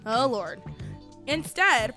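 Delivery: ordinary delivery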